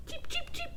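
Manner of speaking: very high, soft cheep, cheep; a little girl's sing-song chick sound. Acted. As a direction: chirp like a baby bird. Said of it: "sang Jasmine".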